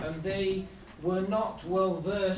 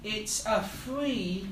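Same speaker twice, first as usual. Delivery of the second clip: usual speaking voice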